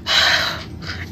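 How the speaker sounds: long voiceless sigh; exasperated sigh. Real, not acted. Storyteller fed up after recalling mother's demands, heavy sigh. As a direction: breathe out heavily, fed up.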